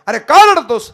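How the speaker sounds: high, loud rustic scolding; Balumama scolding. Acted. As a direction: scold the weeping farmer roughly. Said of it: "बाळूमामाने सांगितलं". (रडणाऱ्या शेतकऱ्याला बाळूमामांचा जोरदार दटावणी आवाज)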